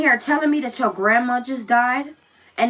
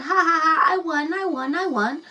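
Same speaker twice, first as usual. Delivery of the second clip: put-on gloating child; 6 semitones higher than usual. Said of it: "rubbing it in her brother's face like".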